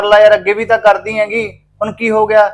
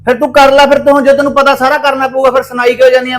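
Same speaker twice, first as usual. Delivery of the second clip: husband loses patience with his nagging wife; exasperated outburst; 6 semitones higher than usual. Acted. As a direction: throw it back at her in frustration. Husband snaps in domestic quarrel.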